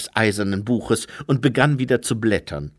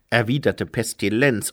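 ordinary delivery

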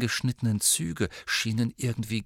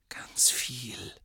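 unvoiced whisper; whispered confession to the teacher; breathed; 3 semitones higher, 6 dB quieter than usual. A narrator's reading. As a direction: breathe it out in awe. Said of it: "hauchte Riddle".

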